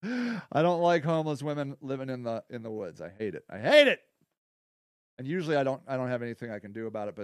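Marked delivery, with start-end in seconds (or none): 3.0-3.4 lower, softer muttered "I hate it"; quiet. Real, not acted. Speaker on homeless women in the woods, soft and low. 3.5-4.0 louder, higher burst of "I hate it"; emphatic. Real, not acted. Speaker on homeless women in the woods, louder and emphatic.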